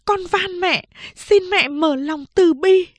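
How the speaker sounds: high, tearful pleading; begging. Acted. Second sister begging mother to save the boy, tearful. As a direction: beg on your knees.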